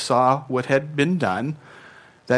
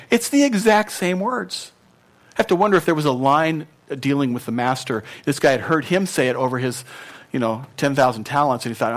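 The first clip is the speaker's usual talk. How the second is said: ordinary delivery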